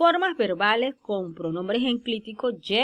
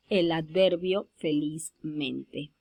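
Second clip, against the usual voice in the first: slow demonstration; 5 semitones lower, 3 dB quieter, much slower than usual. Shown as a demonstration. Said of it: "en el caso de que forme".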